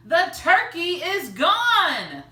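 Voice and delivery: high, loud, slow alarmed cry; shocked cry. Acted. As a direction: shout in disbelief at the empty oven. Father discovers missing turkey.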